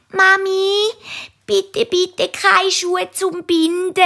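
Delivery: little centipede begging mum in the shoe shop; pleading child. Acted. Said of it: "sagt der kleine Tausendfüßler".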